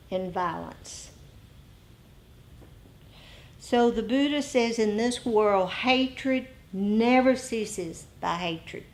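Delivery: usual speaking voice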